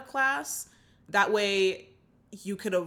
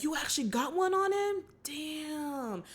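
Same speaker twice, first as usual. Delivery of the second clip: impressed surprise; 5 semitones higher, 4 dB quieter than usual. Retold or imitated. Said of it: "Slim and Roland are just like".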